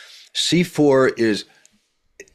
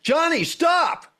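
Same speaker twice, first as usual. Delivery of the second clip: put-on very high, slow shout to stop a child; urgent yell; much slower than usual. Shown as a demonstration. Coach demos compelling voice, a high slow shouted warning. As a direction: yell to save the boy from the truck. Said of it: "you gotta go into the zone".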